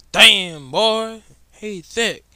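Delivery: loud, high mock hype voice; meme catchphrase. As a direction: bellow it like the viral meme.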